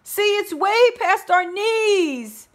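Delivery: very high, loud, crying plea for help; tearful high shouting plea; frightened shout. A narrator's reading. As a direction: beg to be rescued from the snow. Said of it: "now shouted out".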